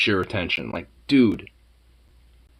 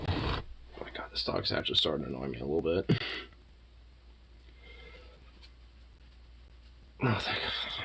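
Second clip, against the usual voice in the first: hushed, fast, annoyed whisper; 8 semitones lower, 15 dB quieter than usual. Real, not acted. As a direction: whisper it, fed up. Muttered annoyed aside.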